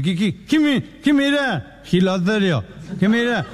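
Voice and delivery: put-on high-pitched drunk picking a fight; mock tough-guy drunk; belligerent drunk. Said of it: "viene la etapa violenta".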